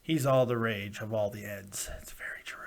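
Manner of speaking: whispered aside; conspiratorial whispered joke. Acted. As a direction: drop to a secret whisper.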